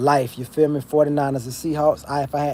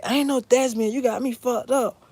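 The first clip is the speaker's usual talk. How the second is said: imitating an outraged mother, pitched way up; mom cussing out; 11 semitones higher than usual. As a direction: shriek it like his mom telling him off. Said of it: "she was like".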